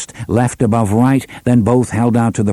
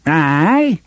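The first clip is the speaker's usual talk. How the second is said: high, hammed-up Hambry drawl; exaggerated Hambry accent; 6 semitones higher than usual. A narrator's reading. Cuthbert answers Roland in mock Hambry accent. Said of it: "in a wildly exaggerated Hambry accent".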